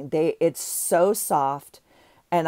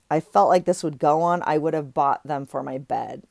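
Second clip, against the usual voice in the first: ordinary talk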